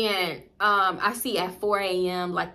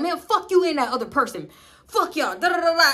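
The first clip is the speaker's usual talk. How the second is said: higher, cursing, hammed-up annoyance; mock angry boyfriend; 8 semitones higher, 5 dB louder than usual. Boyfriend cursing out her and the source, mock angry and higher. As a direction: play the boyfriend blowing up. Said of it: "He'd be like".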